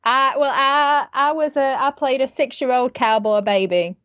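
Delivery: put-on Western child voice; cowboy accent. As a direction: play a little cowboy with a Texan twang. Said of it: "and I spoke like this".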